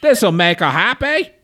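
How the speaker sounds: high, loud cartoon Aussie-Cockney; Australian-Cockney accent. Co-host as the flower guy selling, mock Australian-Cockney accent. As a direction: pitch it up into fake Cockney-Australian. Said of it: "my Australian slash English Cockney accent".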